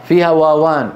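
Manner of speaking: usual speaking voice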